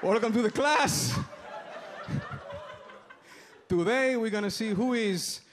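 put-on high, heavy Spanish-accented teacher voice; father taking attendance. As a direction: greet a class in a thick Spanish accent, drawing words out. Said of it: "my impression of my father".

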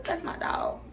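soft unvoiced whisper; whispered aside. Real, not acted. About Mariah, a soft whisper. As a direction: breathe it as a fond aside.

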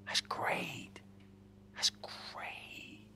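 mock whisper. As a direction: gasp then breathy sarcastic whisper. Mock awe at witness protection.

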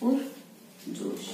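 पुरजोश drawn out slowly in a near whisper; slow soft dictation. Shown as a demonstration. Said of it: "शब्द आ रहा है पुरजोश". (पुरजोश को धीरे फुसफुसाकर बोलना)

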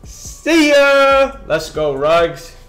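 loud, high celebration, stretched out on the last words; touchdown hype. Real, not acted. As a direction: cheer your receiver into the end zone.

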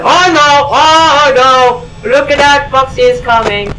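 high, panicky cry; alarmed. Real, not acted. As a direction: call out the approaching danger in alarm. Alarm as an enemy approaches.

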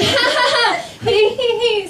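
high forced ha ha he he; fake high laugh. Shown as a demonstration. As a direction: chirp out a squeaky staged laugh. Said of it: "You're like".

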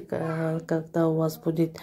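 normal talking voice

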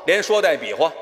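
usual speaking voice

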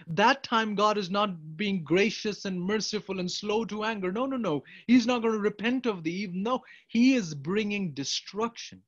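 loud, high, insistent; emphatic loud preaching. Real, not acted. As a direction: hammer home the warning. Preacher contrasting judgment times.

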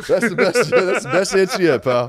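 laughing while praising; cracking up mid-sentence. Real, not acted. Laughing at a funny answer.

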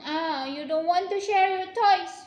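loud teasing reproach, falling on toys; loud mock scold. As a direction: tease them loudly for not sharing. Parent scolding about sharing.

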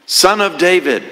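a high, stretched plea to Jesus. Acted. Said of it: "He cries out even louder".

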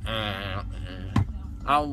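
drowsy and slurring after anesthesia; groggy post-surgery mumble. Real, not acted. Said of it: "wishes just taken out".

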